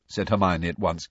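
plain reading voice

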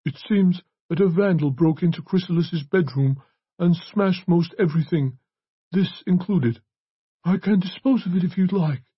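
high, gentle priest's voice. A narrator's reading. Priest explains the smashed bequest to Brennan, gentle. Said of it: "he said in his gentle voice".

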